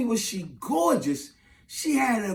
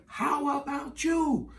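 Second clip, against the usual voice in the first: slow, high, softer voice; imitated woman's question; 6 semitones higher, 6 dB quieter, much slower than usual. Woman asking him in return, slow high imitation. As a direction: draw it out sweetly, pitched up.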